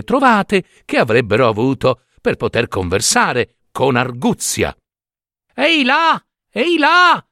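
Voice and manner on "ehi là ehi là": much higher hailing call. Acted. Giambabbeo hailing his brothers from the road, high call. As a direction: hail the brothers from afar. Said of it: "gridò Giambabbeo".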